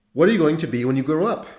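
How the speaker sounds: high, fast outburst; sudden loud question. A narrator's reading. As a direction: blurt it out loudly. Priest suddenly bursts out.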